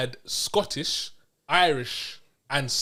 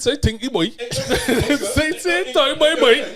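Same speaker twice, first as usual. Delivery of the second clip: high, laughing Bajan accent impression; mock Bajan accent; 9 semitones higher, 6 dB louder than usual. Shown as a demonstration. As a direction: put on a playful Bajan accent, pitched up. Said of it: "Give me an example".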